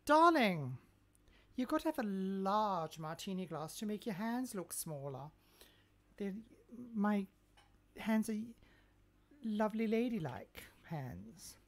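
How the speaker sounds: loud, theatrical ladylike diva; loud hammed-up grande dame; grand diva. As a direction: declaim it like a glamorous hostess. Grand diva about hands.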